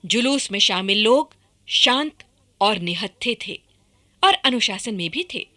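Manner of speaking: ordinary delivery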